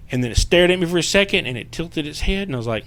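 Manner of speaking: usual speaking voice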